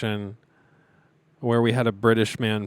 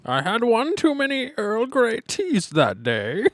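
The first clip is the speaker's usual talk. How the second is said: slow, higher put-on voice; mock posh voice; 11 semitones higher, slower than usual. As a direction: answer like a fussy old aristocrat.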